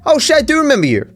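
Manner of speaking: high, surprised tailor voice; imitating surprised tailor. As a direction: mimic his sudden recognition. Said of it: "He's like".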